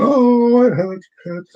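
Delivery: high yelp of a hurt bear, lightly voiced; mock-pained bear voice, lightly done. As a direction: cry out like a cartoon bear that hurt itself. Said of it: "voice acting of the bear".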